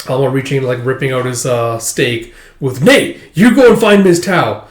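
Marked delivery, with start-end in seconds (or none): 2.8-4.7 higher, louder command; urgent order. Retold or imitated. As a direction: send him off fast.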